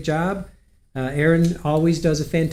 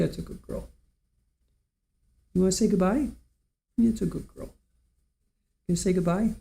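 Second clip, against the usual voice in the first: doggy talk; 4 semitones higher than usual. Real, not acted. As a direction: sweet-talk the pet to come say goodbye. Talking to the dog.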